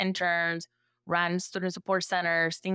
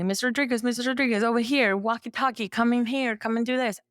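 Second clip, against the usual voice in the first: higher, insistent calls for attention; rapid-fire mock summons, pitched up; imitated calling out; 5 semitones higher than usual. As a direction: mimic people pestering a busy staffer. Acting out constant calls at school.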